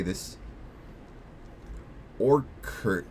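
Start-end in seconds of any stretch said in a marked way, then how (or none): 2.2-3.0 put-on "Orchid" said OR-kid, hard k sound. Shown as a demonstration. Streamer testing the word aloud, hard k. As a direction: say "Orchid" like the flower, with a k. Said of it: "How do you say orchid?".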